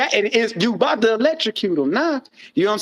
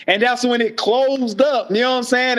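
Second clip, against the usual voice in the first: normal talking voice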